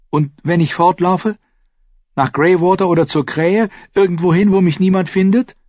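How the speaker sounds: put-on a boy desperate to escape his fate; desperate boy Bran. A narrator's reading. Bran pleads about running away.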